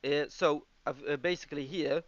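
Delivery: usual speaking voice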